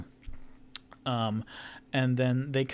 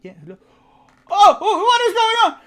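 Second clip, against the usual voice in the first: startled shriek; 14 dB louder than usual. Acted. Surprised halfling at door.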